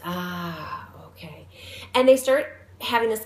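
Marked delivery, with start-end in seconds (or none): 0.0-1.5 soft understanding. Retold or imitated. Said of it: "He goes".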